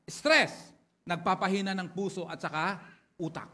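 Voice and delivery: loud, ringing pulpit delivery, words driven home; rally the congregation, punch each point; preaching at full force. Acted.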